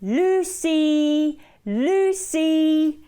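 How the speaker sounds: drawn-out singsong call; storyteller's sustained name call. A narrator's reading. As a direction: call a child from afar, in play. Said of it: "called their daughter".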